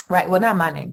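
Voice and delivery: ordinary delivery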